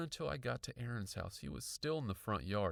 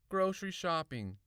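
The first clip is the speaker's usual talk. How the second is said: put-on higher kid's voice, uninterested; muttering brother; 7 semitones higher, 5 dB louder than usual. A narrator's reading. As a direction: answer absently, as a kid. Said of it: "he muttered without turning around".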